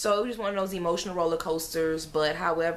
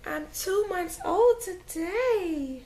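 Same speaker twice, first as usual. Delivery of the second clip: put-on very high, slow baby voice; tiny high baby voice; voicing the baby; 13 semitones higher, much slower than usual. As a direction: speak for the infant.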